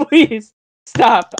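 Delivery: ordinary talk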